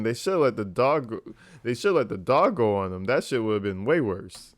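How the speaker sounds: ordinary talk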